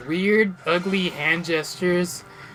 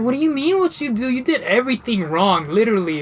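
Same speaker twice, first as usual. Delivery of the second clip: raised-pitch retold scolding; worked-up, higher-voiced comeback; exasperated retort; 6 semitones higher, 4 dB louder than usual. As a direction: throw the question back in disbelief. Retold quarrel with the friend.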